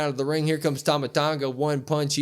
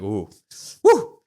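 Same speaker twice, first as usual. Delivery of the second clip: excited ooh woo; 3 semitones lower, 6 dB louder than usual. Retold or imitated. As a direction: whoop like a thrilled fan. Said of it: "I'm like".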